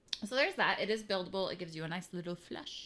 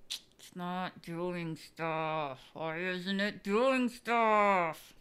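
slow frustration; 6 dB louder, much slower than usual. Real, not acted.

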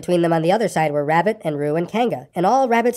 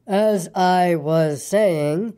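stern; much slower than usual. A narrator's reading. Eeyore silencing the interruptions, stern slow drawl. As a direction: drag each word out sternly. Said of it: "said Eeyore loudly and sternly".

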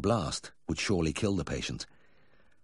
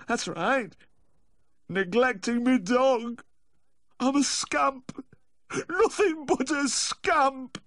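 high, slow, loud lament; hammed-up remorseful wail; wailing self-reproach; 4 dB louder, much slower than usual. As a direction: wallow in guilt, stretching each word. Owner wails in guilt.

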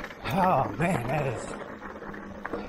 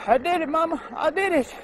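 high, jubilant cry; real triumphant shout to mama; excited high call; 13 semitones higher than usual. Real, not acted.

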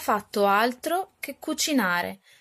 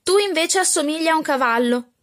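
higher, louder mocking jab; catty retort; 7 semitones higher, 4 dB louder than usual. A stepsister mocks her sister in a mildly raised catty voice. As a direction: throw the insult back in a lightly voiced brat. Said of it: "replicò Arabella".

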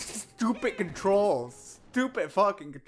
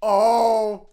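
frustrated groan; 7 dB louder, much slower than usual. Real, not acted. Game failure.

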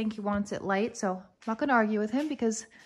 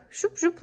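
quick, high-pitched "shoop, shoop" sound effect; imitated shoop noises; 9 semitones higher than usual. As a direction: sketch a shape with little swoosh noises. Mimes the kitchen's boxy shape with noises.

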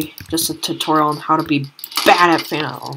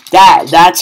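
excited loud; 2 semitones higher, 12 dB louder than usual. Real, not acted. Celebrating trick shot.

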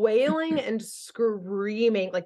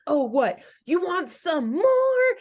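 put-on a taunting tough-girl voice, pitched up and slowed; 9 semitones higher, 3 dB louder than usual. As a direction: goad the beaten villain like a scrappy brawler. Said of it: "That sounds like Buttercup".

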